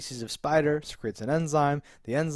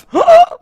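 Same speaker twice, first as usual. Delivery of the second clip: loud gasp; 16 dB louder than usual. Real, not acted. Excited reaction to the idea.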